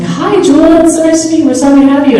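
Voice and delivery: high, cheery welcoming voice; friendly greeting. Retold or imitated. Producer welcomes the actor on set, high cheery tone. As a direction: greet the new actor brightly. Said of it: "was like".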